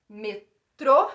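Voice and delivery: "Metrô" split, with a loud TRÔ; stress on last syllable. Shown as a demonstration. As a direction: punch the final syllable of "Metrô". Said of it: "É o trô".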